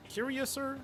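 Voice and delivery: "curiouser" squeaked very high; high squeaky curiouser. Shown as a demonstration. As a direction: pitch "curiouser" way up.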